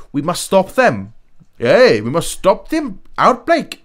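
loud, drawn-out mock American accent. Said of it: "sorry, it's my American accent".

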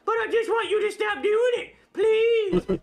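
high pleading puppet voice; squeaky ventriloquist dummy. Acted. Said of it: "my ventriloquist dummy".

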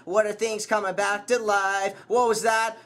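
ordinary talk

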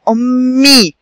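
"أمي" with a long hummed m and clear final ee; doubled m demonstration. Shown as a demonstration. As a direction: hold the double m in "أمي" for the learners. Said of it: "معايا يا أصدقائي؟".

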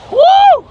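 high loud whoop of delight; joyful whoop. Real, not acted. Flier to viewers, a high whooping cheer. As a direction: let out a big happy cheer.

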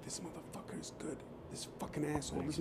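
whispered aside, barely any voice; hushed whisper. Retold or imitated. Husband whispering to his wife in class, hushed whisper. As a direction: lean in and whisper so the class can't hear.

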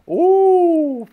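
very high, loud long "Ooh"; exaggerated high pitch. Shown as a demonstration. Imitating a Wisconsin coworker's O, comically high-pitched. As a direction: overdo the Wisconsin O, shrill-high. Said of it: "just not as high-pitched as that".

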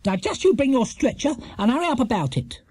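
high-pitched stern order. A narrator's reading. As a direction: order him to get moving. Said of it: "said Missus Bird sternly".

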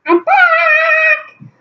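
very high bright announcement; excited return. Real, not acted. Girl popping back on camera to viewers, very high excited. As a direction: pop back in with a cheer.

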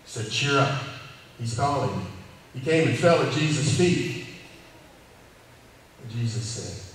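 earnest sermon voice rising and falling; preacher telling a Bible story to his congregation; preaching. Acted. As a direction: preach it to the arena crowd.

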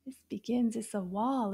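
ordinary talk

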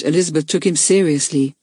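ordinary delivery, nothing marked

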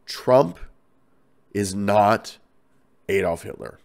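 each chunk set apart by long pauses; emphatic. Real, not acted. Podcaster stating a strongly felt view on Trump, emphatic. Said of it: "I feel very strongly about this".

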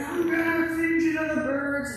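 hammed-up battle taunt; giant's taunt. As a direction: sneer it like a swaggering giant. Goliath taunting David before battle.

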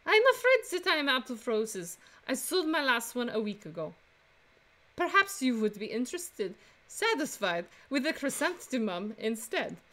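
put-on French accent, lilting upward; female French accent. Flower seller greeting customers, female French accent. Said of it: "do another female French accent".

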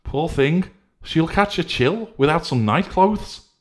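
high, loud fretting voice; Decency fretting over the girl, high and loud; crying out. Acted. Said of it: "cried Decency".

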